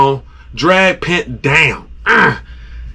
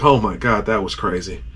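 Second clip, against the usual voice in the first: hushed disbelief; 10 semitones lower, 7 dB quieter than usual. Retold or imitated. Awed at catch.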